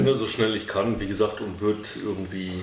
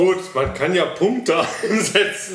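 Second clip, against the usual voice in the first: laughing; 6 semitones higher, 4 dB louder than usual. Real, not acted. Player laughs about spending points.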